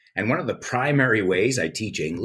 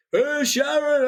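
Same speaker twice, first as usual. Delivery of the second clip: much higher, mumbled British rocker voice; slower than usual. Retold or imitated. Host recalling a rock star calling his wife, high mimicry. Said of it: "The first time I heard him speak".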